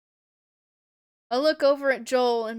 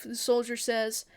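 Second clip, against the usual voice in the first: ordinary delivery, nothing marked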